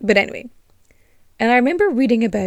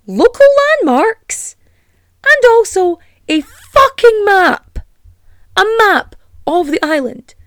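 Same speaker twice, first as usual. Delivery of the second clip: louder and higher Irish-history rant; indignant outburst; 9 semitones higher, 7 dB louder than usual. Real, not acted. Host venting to listeners about the book's map, loud and high. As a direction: pitch it up in outrage at the map.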